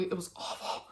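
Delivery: barely voiced, choked whisper; words almost vanish under tears; holding back tears. Real, not acted. Remembering her grandpa's sudden death, tearful whisper. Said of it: "I'm gonna try not to cry".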